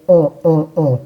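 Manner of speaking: on as one nasal vowel sound; nasal on, one single sound; French nasal on. Shown as a demonstration. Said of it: "es un solo sonido". (on nasal, un solo sonido)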